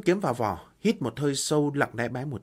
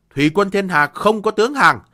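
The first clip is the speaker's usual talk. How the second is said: higher, louder proud cry; defiant shout; 6 semitones higher, 10 dB louder than usual. Acted. Defiant general repeats refusal.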